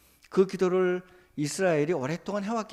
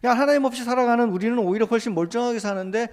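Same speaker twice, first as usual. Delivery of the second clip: higher and louder scornful jeer; a jeering voice, raised and louder; mocking taunt; 5 semitones higher, 5 dB louder than usual. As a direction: sneer at the believer the way the mockers do. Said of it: "라며 우리를 조롱한다는 겁니다".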